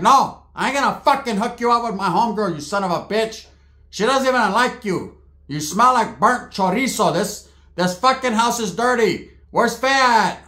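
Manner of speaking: imitated indignant rant; aunt's angry tirade. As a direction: tear into him as the outraged aunt.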